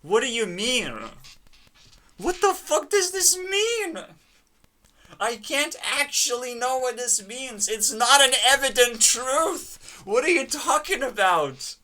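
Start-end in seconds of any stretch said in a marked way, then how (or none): 2.2-11.8 high, loud disbelieving rant; higher, louder fed-up outburst; exasperated shout. Real, not acted. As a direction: throw up your hands and yell it. Exasperated at the sentence.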